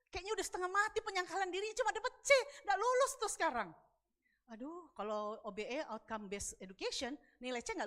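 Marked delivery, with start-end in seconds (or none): 0.1-3.7 high, loud, fast rebuke; animated mock reprimand; acted scolding verdict. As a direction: hand down a failing grade with exasperation. God grading her, acted out.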